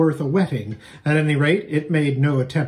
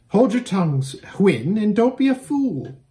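high, sharp scolding of the mare; sharp rebuke; 7 semitones higher than usual. Acted. Girl hushing her mare Hwin, sharp and high. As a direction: cut her off, hush her now. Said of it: "said the other".

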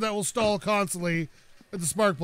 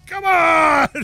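loud, higher yell at the car; frustrated shout; 9 semitones higher, 11 dB louder than usual. Real, not acted. Car part won't stay on.

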